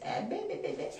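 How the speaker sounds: high, soft mock jabber; high little burst of mock chatter; mimicked yapping. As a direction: imitate a woman yapping away. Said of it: "she's still like this".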